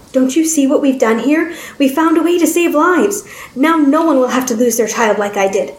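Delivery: scientist pleading his case. Acted. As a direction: appeal to them as a driven scientist.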